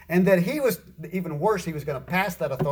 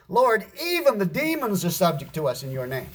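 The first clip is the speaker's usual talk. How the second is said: raised pitch, "even" punched and held; excited stress on EVEN; 5 semitones higher than usual. Acted. Disciples excitedly telling Jesus of demons, stressing "even". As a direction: exclaim it with heavy weight on "even". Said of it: "they excitedly declared this".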